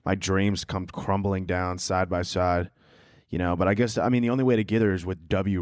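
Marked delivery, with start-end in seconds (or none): 0.0-2.7 low, crushed mumble of disappointment; deflated despair. Acted. Fan crushed that she is nice, low and deflated. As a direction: sink into slow, gloomy letdown. Deadpan despair after gushing quote.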